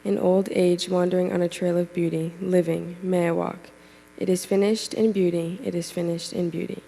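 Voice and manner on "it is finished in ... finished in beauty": ordinary talk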